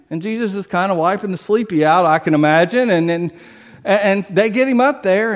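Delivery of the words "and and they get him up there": ordinary delivery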